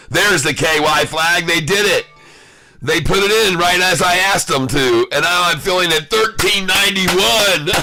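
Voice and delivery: triumphant whoop ending in a laugh; gleeful excitement. Real, not acted. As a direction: crow over the win. Sellers cave exactly as he asked.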